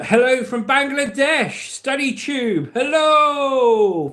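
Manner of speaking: high, loud, drawn-out hello; theatrical high-pitched shout-out; hammed-up greeting. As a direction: call out a big showy greeting. Hammed-up greeting read from chat.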